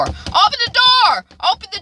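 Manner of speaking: high, loud cop yell at the door; police shouting. Retold or imitated. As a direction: bang on the door and bark it out.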